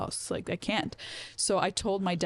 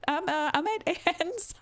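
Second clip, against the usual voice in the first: high, hesitant fib; feigned innocence; 12 semitones higher than usual. Retold or imitated. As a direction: imitate lying to Dad, stalling in a high voice. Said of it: "I'm still lying".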